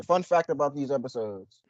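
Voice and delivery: usual speaking voice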